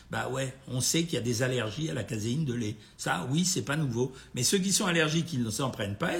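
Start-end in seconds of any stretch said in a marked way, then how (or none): none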